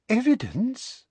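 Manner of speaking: high, syrupy, falsely sweet question; a toad-like smile you can hear, high and sugary; sickly sweet Umbridge. Acted. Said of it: "with that horrible, wide, toad-like smile".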